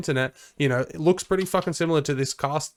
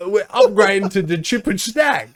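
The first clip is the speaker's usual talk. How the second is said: loud, high hammed-up Australian accent; mock Australian accent; 7 semitones higher, 9 dB louder than usual. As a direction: play a rowdy Aussie bloke, loud and high. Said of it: "we are in Australia".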